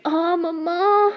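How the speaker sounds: loud, drawn-out cry of sudden neck pain. A narrator's reading. Girl clutching her stiff neck cries out loud in pain. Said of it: "वो एकदम गर्दन पकड़ती कहती".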